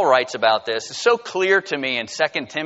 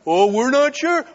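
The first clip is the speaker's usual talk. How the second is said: high-pitched, louder mock kids' reply; hesitant kids; 9 semitones higher, 5 dB louder than usual. Kids answering an evangelist's offer of heaven, mock doubt. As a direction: play the doubtful children, pitched up.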